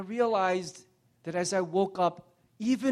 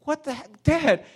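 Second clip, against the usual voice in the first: put-on high, stunned kid's voice; a boy who can't believe it; hurt child's protest; 10 semitones higher, 6 dB louder than usual. Son answering his raging father, high and stunned. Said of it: "He's like".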